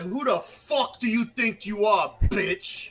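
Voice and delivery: loud, slow, high New York accent; New York cursing. Shown as a demonstration. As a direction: spit out the curse like a Brooklyn tough. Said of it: "like, perfect one example".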